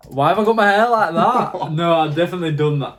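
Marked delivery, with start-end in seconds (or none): 0.1-1.7 surprised. Real, not acted. Laughing at own hair.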